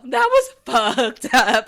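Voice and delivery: loud, drawn-out verdict through a laugh; slow it down and push it out loud, laughing; laughing disbelief. Real, not acted. Reacting to Randall's dig at Jim's family, laughing disbelief. Reacting, amused shock at a low blow.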